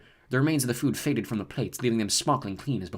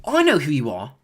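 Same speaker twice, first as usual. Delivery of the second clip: sudden; 3 semitones higher, 7 dB louder than usual. A narrator's reading. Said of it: "said Ron suddenly".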